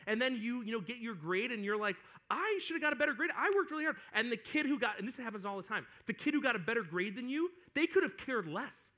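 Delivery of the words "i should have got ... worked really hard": high-pitched mock protest; hammed-up whiny indignation; indignant student. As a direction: complain that it's unfair. Acting indignant student over grade.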